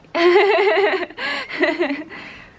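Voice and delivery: loud, high-pitched laughing fit; real, helpless high laugh; real burst of laughter. Real, not acted. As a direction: burst out laughing at something awful. Reacts to bad accent clip.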